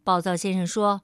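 ordinary delivery

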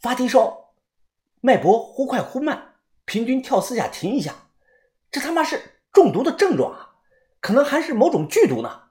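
urgent worried diagnosis; anxious. A narrator's reading. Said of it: "立即非常着急地说道".

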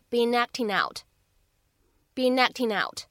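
"been acting out" linked as "bee-nacting-out"; "been acting out" joined without gaps; linked reading. Shown as a demonstration. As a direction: carry each final consonant into the next word of "been acting out". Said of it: "前三个单词当中两两连读".

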